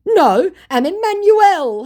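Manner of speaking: high, loud, drawn-out protest; mock scammer. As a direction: announce the name in mock pleading, stretched up high. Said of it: "he said".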